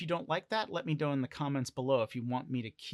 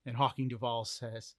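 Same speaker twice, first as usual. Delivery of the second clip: usual speaking voice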